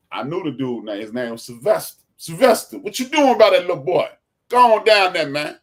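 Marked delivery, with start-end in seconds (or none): 2.2-5.6 loud and high, run him off; stern shout. Retold or imitated. Old neighbor man running Sylvester off, loud stern shout. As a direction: bark him off the block.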